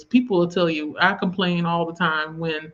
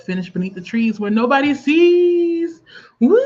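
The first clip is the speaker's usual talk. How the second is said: celebratory cheer; 7 semitones higher, 6 dB louder than usual. Real, not acted. Host cheers a viewer's finished book, celebratory. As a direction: build into a loud, high whoop.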